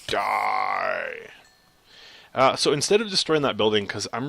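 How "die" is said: very low, drawn-out growl; mock menacing. Player striking an enemy, deep mock menace. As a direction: drop way down for a killing cry.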